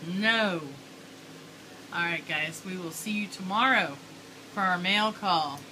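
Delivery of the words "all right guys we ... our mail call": usual speaking voice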